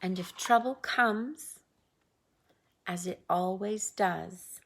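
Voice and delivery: ordinary delivery, nothing marked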